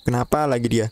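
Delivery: ordinary talk, nothing marked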